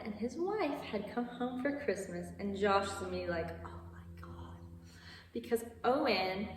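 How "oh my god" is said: breathy whispered groan of dread. Retold or imitated. Josh hearing his annoying brother arrived, dreading whisper. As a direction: whisper it like doom just walked in.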